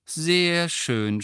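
high, put-on cheeriness; falsely cheerful. A narrator's reading. Grandmother praising a worthless gum wrapper, with false cheerfulness. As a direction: fake some delight for her sake. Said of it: "mit falscher Fröhlichkeit in der Stimme".

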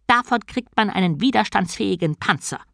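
high-pitched tortoise voice; turtle's voice. A narrator's reading. Turtle god explaining the benefit of sleep, high voice. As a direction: pipe up as an old-world turtle god. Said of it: "sagte Om".

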